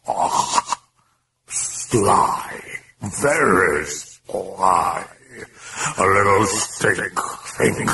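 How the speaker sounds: slow, strange demon drawl. Acted. Demon taunts Karras, slow and eerie. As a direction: speak as the mocking demon.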